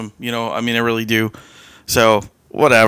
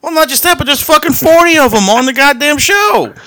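excited rant; 14 semitones higher than usual. Real, not acted. Ranting about forty members.

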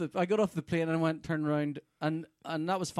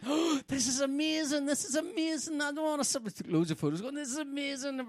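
awestruck gushing; 12 semitones higher than usual. Retold or imitated.

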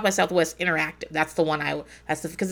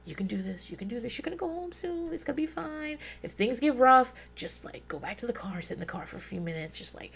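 much softer, near whisper; self-soothing under breath; 4 semitones higher, 11 dB quieter than usual. Retold or imitated. Calming herself in the car, soft murmur. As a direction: murmur to calm yourself down. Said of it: "talking to myself in the fucking car".

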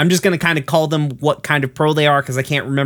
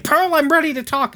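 much higher, eager voice, all set to talk; eager Steven. Retold or imitated. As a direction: pipe up like a keen kid who's ready at last. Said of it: "Steven quickly returns home being like".

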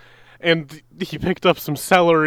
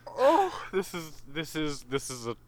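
softer, queasy and fighting nausea; 5 semitones lower, 12 dB quieter than usual. Real, not acted. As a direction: hold it down, barely getting words out. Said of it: "this is testing my fortitude".

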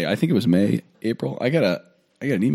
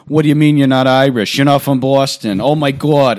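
loud, higher mock outburst; in-your-face Irish American; 4 semitones higher, 7 dB louder than usual. Mocking obnoxiously Irish people to a co-host, loud and in-your-face. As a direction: push it loudly into the listener's face. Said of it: "in your face".

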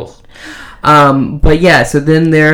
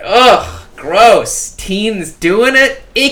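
high, grossed-out groan; disgusted; 6 semitones higher, much slower than usual. Pretend. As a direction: recoil in mock disgust. Mock disgusted reaction to teens.

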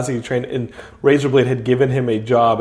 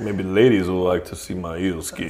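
Razorblade flirting; 8 semitones lower, 5 dB quieter than usual. Acted.

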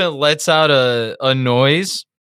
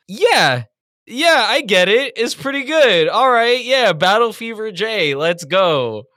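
hyped fan; 7 semitones higher than usual. Retold or imitated. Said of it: "I go".

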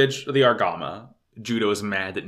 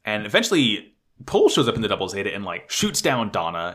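ordinary talk

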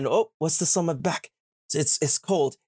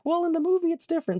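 much higher, nitpicky mock voice; mocking whiny fan; 14 semitones higher, much faster than usual. Mocking viewers who correct her, high whine. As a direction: mimic an annoying movie fan. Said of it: "don't come at me if it's like".

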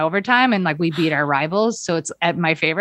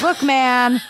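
loopy sick self; 5 semitones higher than usual. Retold or imitated. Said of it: "I was so, like, out of my mind".

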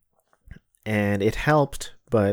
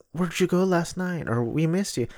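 mock sweet waiter; 8 semitones higher, 4 dB quieter than usual. Mimicking the overly attentive waiters.

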